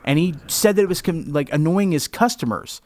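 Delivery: ordinary talk, nothing marked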